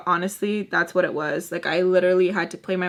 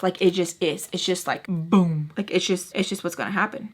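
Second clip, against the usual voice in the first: normal talking voice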